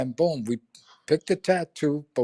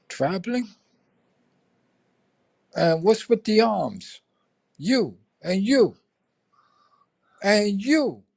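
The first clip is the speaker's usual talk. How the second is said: higher, slow, pointing at each one; suspicious first sergeant; 7 semitones higher, slower than usual. Retold or imitated. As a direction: grill the troops one by one. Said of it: "Top looks at us and he goes".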